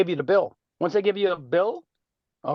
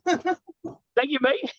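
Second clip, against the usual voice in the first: playful mock English accent, high; imitating a Londoner's thank-you; 9 semitones higher, much slower than usual. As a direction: echo her with a cheeky English "mate". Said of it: "I love your accent".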